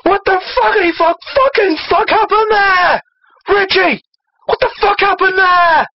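very high put-on cursing voice; mock outrage. Reader playing a random cursing character, mock outrage. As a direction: squeal the question in hammed-up disbelief.

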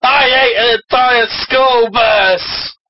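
high, slow hammed-up exclamation; mock outburst. Friend riffing as the villain, mock outburst. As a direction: draw the complaint out high and indignant.

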